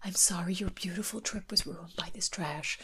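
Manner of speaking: breath-only imitated apology; hushed, unvoiced impersonation; mock whisper. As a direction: whisper it as if confiding, in mimicry. Mimics Heather's hushed apology.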